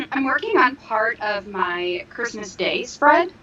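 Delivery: ordinary talk, nothing marked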